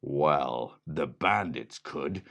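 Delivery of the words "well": plain reading voice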